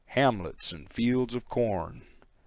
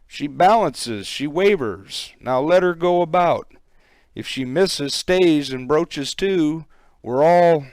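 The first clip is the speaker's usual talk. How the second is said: higher and louder as the danger builds; tense, rising alarm; 5 semitones higher, 5 dB louder than usual. Acted. Crew member watching the boat heel, growing alarm. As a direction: build the panic line by line.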